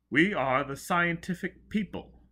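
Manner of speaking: high, loud grand announcement; proud proclamation. Acted. Joseph proudly proclaims his tribe to Foyle.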